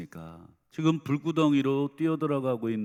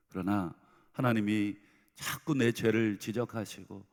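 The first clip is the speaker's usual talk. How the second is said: normal talking voice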